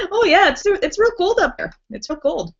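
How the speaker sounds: put-on high, playful North Dakota accent, easing down at the end. Recalling visits up north, mock North Dakota accent. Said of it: "talking in our North Dakota accents".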